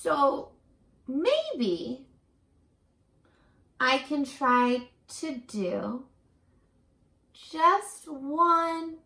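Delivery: unhurried storybook reading, lifting on maybe and small; reading to children. A narrator's reading. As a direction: read it out gently to young listeners. Reading picture book aloud.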